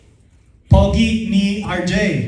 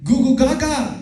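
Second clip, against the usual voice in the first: mock baby talk, a little higher; baby babble; 5 semitones higher than usual. Trainer imitates newborns, baby babble. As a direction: babble like an infant. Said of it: "they're all, like, quiet and".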